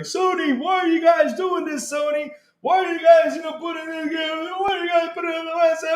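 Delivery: high, shouted whiny fan complaint; mock angry fan. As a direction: parody a furious fanboy yelling at Sony. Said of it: "be like".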